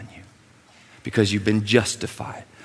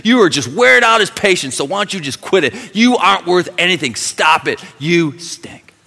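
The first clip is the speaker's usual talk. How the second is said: high, loud jeering attack; Satan tearing down a believer; accuser taunting; 11 semitones higher, 10 dB louder than usual. Retold or imitated. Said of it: "He will say".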